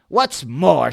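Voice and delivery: loud snapping retort; snapped. Acted. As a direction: snap it out at him. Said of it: "snapped the Lorax".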